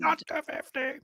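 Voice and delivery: very high, softened imitation scream; a tiny, strangled wail; mock distant cry. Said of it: "cry ... so loud and resounding".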